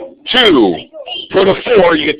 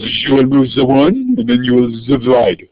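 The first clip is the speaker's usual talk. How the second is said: put-on French accent. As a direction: attempt an amateurish French accent. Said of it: "supposed to be a French accent".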